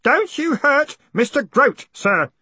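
high, loud, drawn-out warning; ringing voice. A narrator's reading. Boy warns off the man threatening Groat, ringing. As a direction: ring out in a high protective cry. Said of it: "said a ringing voice behind Moist".

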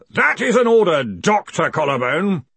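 loud, forceful order slowing on the name; booming authority; commanding. A narrator's reading. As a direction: order him sternly, brooking no refusal. Ridcully commands Collarbone to continue.

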